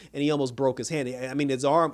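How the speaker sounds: normal talking voice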